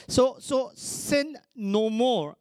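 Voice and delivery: preacher's slow hammer; slow emphatic. Real, not acted. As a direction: stretch each word for weight. Preacher stretches key phrase.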